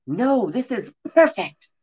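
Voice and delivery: high, slow, bright exclamation; delighted with the room; cheerful. Acted. Cordelia cheerfully approving the house. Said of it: "said Cordelia Cronenberg cheerfully".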